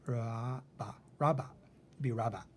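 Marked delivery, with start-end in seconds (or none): 0.1-1.5 "Ra-ba" sounded out as "rah-bah", then blended as "raba"; deliberately wrong "raba", first vowel like "hot"; short O mispronunciation. Shown as a demonstration. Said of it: "it's short". 2.1-2.4 "raba" with the first vowel like "hot"; deliberately wrong "raba", short O instead of long; short O mispronunciation. Shown as a demonstration. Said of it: "it's short".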